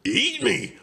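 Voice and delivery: high, loud horrified squawk; piercing high outburst; indignant shriek. Acted. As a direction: shriek it in disbelief. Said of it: "Arnie shrieked".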